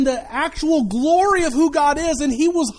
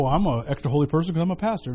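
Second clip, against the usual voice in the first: mock smug pastor; 8 semitones lower, 4 dB quieter, faster than usual. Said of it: "sit here and go".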